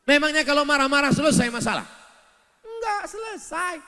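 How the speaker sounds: fast, high, loud rhetorical question; excited. Real, not acted. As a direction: fire it at the crowd. Preacher mocking angry husbands. (bertanya dengan suara tinggi bersemangat)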